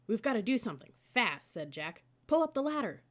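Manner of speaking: loud and high, hurried; urgent. Acted. Jack to Annie as ninjas approach, urgent command. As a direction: urge her to move fast.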